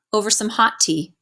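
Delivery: usual speaking voice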